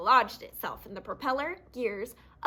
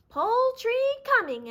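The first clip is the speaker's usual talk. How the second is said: high, loud sly fox voice; sly fox announcing; 11 semitones higher, 6 dB louder than usual. Acted. Fox spotting a chicken, sly loud announcement. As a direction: call it out like a hungry fox. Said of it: "announced Foxy Loxy".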